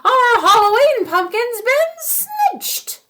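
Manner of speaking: put-on high, loud, slow wail of an old woman; ancient woman's cry. Old woman tells husband the pumpkin is stolen, wailing high. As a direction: wail it like an outraged old crone. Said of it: "she cried in a seven hundred-year-old voice".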